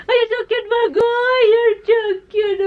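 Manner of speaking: high-pitched squealing pet talk; cooing over pet. Real, not acted. Owner gushing to her pet, squealing high with delight.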